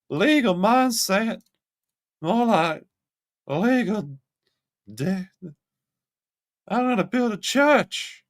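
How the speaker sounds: high, drawn-out mocking tone; mocking sing-song. Real, not acted. Streamer mocking Johnny Somali's legal mindset, sing-song. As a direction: ridicule the title.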